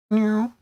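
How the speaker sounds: a high, cutesy meow like a kitten; high cat meow. Pretend. Streamer meowing at her co-host, high and cutesy.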